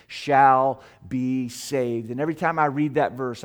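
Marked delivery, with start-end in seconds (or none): 0.1-2.1 "shall" stretched out and set apart before "be saved"; stress on shall. Real, not acted. Preacher quoting Romans to the congregation, "shall" emphatic. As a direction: lean slowly on "shall", spacing out the words after it. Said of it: "I like to emphasize the word shall".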